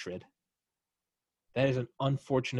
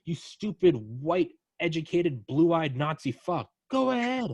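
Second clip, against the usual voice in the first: higher, louder, slowed mock provocation; mock taunting dare; 6 semitones higher, 4 dB louder, slower than usual. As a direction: goad the trolls with exaggerated bravado. Daring haters to insult him.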